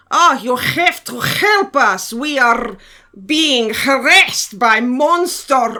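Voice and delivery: slow, loud, hammed-up Russian accent; overacted Russian villager pleading for help; mock Russian peasant accent, drawn out and loud; terrible Russian accent. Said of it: "in their terrible Russian accents".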